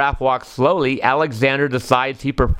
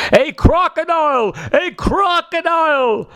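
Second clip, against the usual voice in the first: storybook alarm cry, stretching the words; lusty yell; 13 semitones higher than usual. A narrator's reading. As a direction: yell a warning in a child's high pitch. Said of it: "Palm gives a lusty yell".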